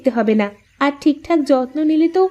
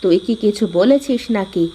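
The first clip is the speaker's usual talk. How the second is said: put-on lower, older mother-in-law asking what happened; concerned older woman, lower voice; 4 semitones lower than usual. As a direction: play the worried mother-in-law, pitched low. One actor voices the mother-in-law.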